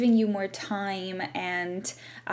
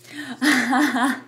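amused burst of laughter, higher pitched; real laugh breaking out, louder; 4 semitones higher, 5 dB louder than usual. Real, not acted. Tarot reader amused by the card just pulled, laughing. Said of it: "I'm laughing so much".

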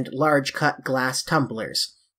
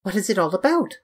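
mildly petulant, pitched up; a touch of spoilt impatience, in a higher voice; 6 semitones higher, faster than usual. A narrator's reading. Dorian to the painter who wants a serious talk, petulant. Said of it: "cried Dorian in his petulant way".

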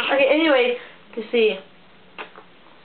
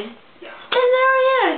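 dramatic reveal; 10 semitones higher, slower than usual. Real, not acted. Dramatic retelling.

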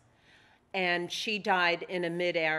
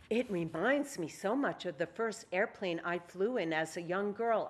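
normal talking voice

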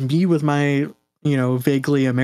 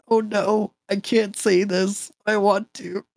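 higher-pitched dismayed inner thought; mock dismay; 7 semitones higher, slower than usual. Student recalling thoughts on professors' feedback, mock dismay. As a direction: imitate your own reluctant inner voice.

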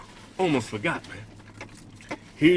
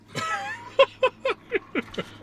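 high laugh; 5 dB quieter than usual. Real, not acted. Laugh after reveal.

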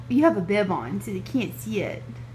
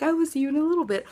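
mock sign-off; 6 semitones higher, much faster than usual. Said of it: "I feel the need to say that".

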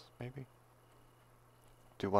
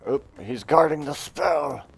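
ordinary delivery

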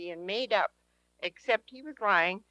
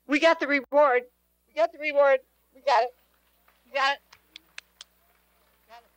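higher, louder, triumphant repeats; exultant preaching; 7 semitones higher, 7 dB louder than usual. Real, not acted. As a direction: shout the victory to the congregation.

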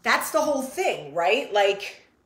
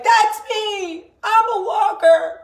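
excited; 9 semitones higher, 8 dB louder, slower than usual. Retold or imitated. Kid voice answering the announcement.

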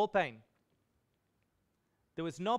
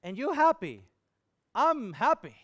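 happy exclaim; 5 semitones higher, 4 dB louder than usual. Retold or imitated. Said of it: "I said".

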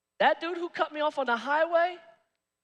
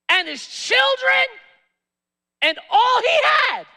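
preaching shout; 12 semitones higher, 9 dB louder than usual. Real, not acted. Preacher building on penalty.

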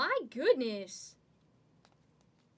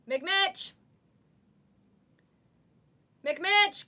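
calling out; 6 semitones higher, 7 dB louder than usual. Real, not acted. Calling out for a hit.

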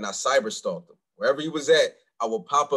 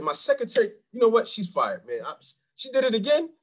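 raised, fake-annoyed bluff; mock indignant excuse; 10 semitones higher than usual. Bluffing at the door about a secretary, mock indignant. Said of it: "I said".

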